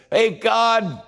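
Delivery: a dancing man begging God to say it; high, giddy Gideon begging to hear he's loved. Acted. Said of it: "and he says".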